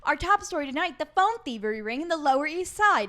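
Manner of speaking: loud, punchy news-anchor read; mock newscast intro. Host teasing tonight's top story, loud mock news anchor.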